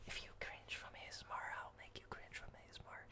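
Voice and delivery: soft breathy ASMR whisper into the mic; ASMR whisper for the stream. Acted. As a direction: whisper close and intimate like an ASMR video.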